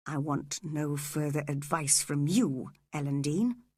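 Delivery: low, icy dismissal; cold. A narrator's reading. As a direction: coldly shut the servant out. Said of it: "said Mister Edgar coldly".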